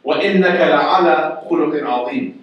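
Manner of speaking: quick Arabic verse recitation; Quran recitation. Shown as a demonstration.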